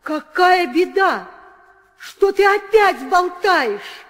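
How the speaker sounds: high, loud anxious demands; alarmed questioning. Acted. Queen anxiously questions her nurse about her son. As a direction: press her in fear.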